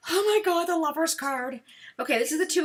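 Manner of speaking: loud, high oh my God at the reveal; delighted surprise. Real, not acted. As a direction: gasp it out, thrilled by the card. Reacting to the card flip.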